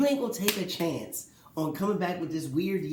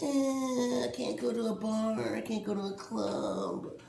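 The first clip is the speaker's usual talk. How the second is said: mock whine, drawn out; whiny complaining; 6 semitones higher, slower than usual. Mocks Jordan's complaints about going out, whiny. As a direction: lightly imitate a star moaning. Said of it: "kinda whiny".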